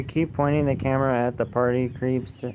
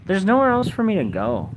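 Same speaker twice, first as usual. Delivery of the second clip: higher and louder, fed up with the accusations; exasperated outburst; 7 semitones higher, 4 dB louder than usual. Real, not acted. Streamer snapping back at chat calling him a creep, exasperated outburst. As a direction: blurt it out, sick of defending yourself.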